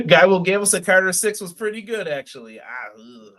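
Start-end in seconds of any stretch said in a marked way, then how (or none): none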